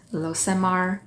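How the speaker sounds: usual speaking voice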